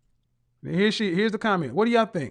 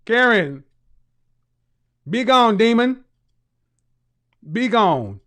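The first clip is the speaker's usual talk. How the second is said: mock exorcist; 5 dB louder, slower than usual.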